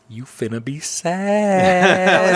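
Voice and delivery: slow, drawn-out teasing warning; knowing warning. Retold or imitated. Leaning over to warn her in the theater, slow and teasing. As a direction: stretch it out like you know what's coming. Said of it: "I lean over to her and I was like".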